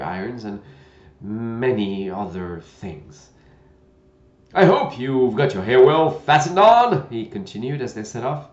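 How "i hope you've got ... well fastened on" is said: put-on loud, gentle old knight; a kindly, bumbling old knight. Knight voiced in story.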